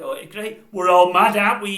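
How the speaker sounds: high, very loud manic mimicry; hammed-up zany presenter voice; Rick Mail. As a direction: blurt it out like a madcap comic. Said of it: "He just sounded like Rick Mail".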